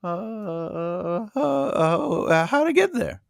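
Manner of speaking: nervous voice. Pretend. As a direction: stammer as if very nervous. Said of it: "That's my nervous voice".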